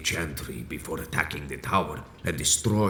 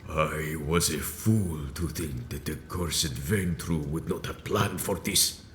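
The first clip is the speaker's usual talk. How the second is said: ordinary talk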